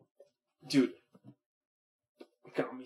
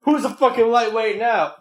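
gloating laugh; 7 semitones higher, 5 dB louder than usual. Real, not acted. Gloating after the catch.

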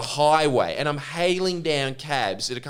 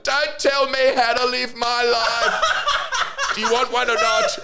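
hammed-up high-pitched indignation; womanly huff; 8 semitones higher than usual. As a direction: imitate him in a high, huffy womanly voice. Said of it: "womanly way of just being like".

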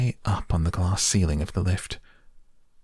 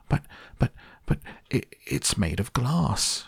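stammered shout; 5 semitones higher than usual. A narrator's reading. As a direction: stumble on the buts, then stretch out the protest. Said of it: "shouted Grandpa Joe".

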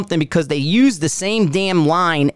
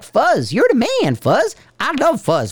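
much higher, hammed-up adoring praise; super positive; 9 semitones higher than usual. Owner playing super positive about Fuzz the dog, mock gushing. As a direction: gush in a high mock-positive voice. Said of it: "I'll be super positive".

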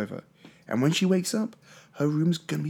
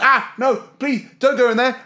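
mock panic; 8 semitones higher, 6 dB louder than usual. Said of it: "She's like".

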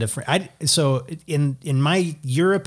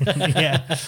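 usual speaking voice

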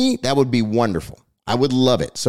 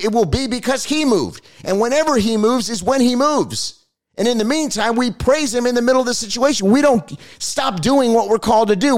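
higher-pitched fervent sermon voice; preaching; 7 semitones higher than usual. Acted. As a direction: preach it to the congregation with rising fervor. Preaching to his audience.

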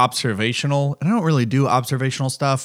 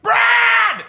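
sudden loud yell, much higher; yelling a name; 4 dB louder than usual. Retold or imitated. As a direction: shout him awake all at once. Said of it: "shaking them and being like".

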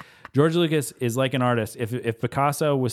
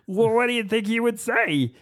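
high, strained director's voice, far higher than his own; Irvin Kershner impression; 12 semitones higher, 3 dB louder than usual. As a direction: squeeze the voice up into a director asking a question. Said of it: "That's how he talks".

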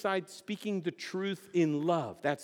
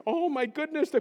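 high-pitched scandalised gasp of words; hammed-up dismay; mock shocked reaction; 10 semitones higher than usual. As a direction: play aghast at the outburst, high. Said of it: "And the Norwegians would say".

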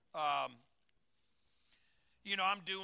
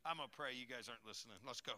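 normal talking voice

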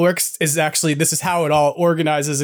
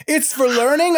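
incredulous echo; 9 semitones higher than usual. Retold or imitated. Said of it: "And I went".

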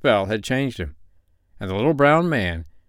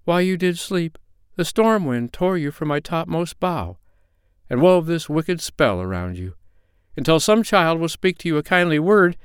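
higher, gentle grieving tree voice; sorrowful pine tree; 7 semitones higher than usual. A narrator's reading. The tall pine tree explains the curse to the sprite, high and sorrowful.

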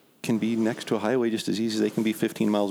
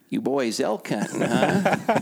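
mock slurred drunk drawl, pitched up; drunk bum voice; 5 semitones higher, much slower than usual. Drunk bum asks hunters in camo, lightly mocked. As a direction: play the drunk stranger teasing. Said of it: "looks at us dressed in camo and says".

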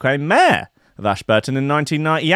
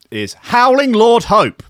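higher, louder, slow reveal of the name "Howling"; grand announcement; 9 semitones higher, 5 dB louder, slower than usual. Acted. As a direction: announce "Howling Lord Hope" like a fanfare. Reads out the leader's name.

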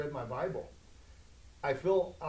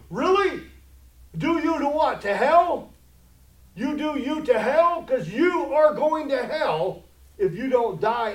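loud, high-pitched challenge to the congregation; indignant preaching; 7 semitones higher, 8 dB louder than usual. Real, not acted. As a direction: fire it out as a stern warning of hell.

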